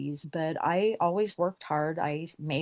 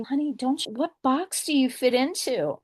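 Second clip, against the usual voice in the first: higher, prying patronizing lady voice; a hint of a nosy, patronizing lady asking; condescending woman; 7 semitones higher than usual. Condescending woman quizzing her.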